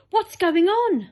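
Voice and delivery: high alarmed cry; startled boy crying out. Acted. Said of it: "cried James".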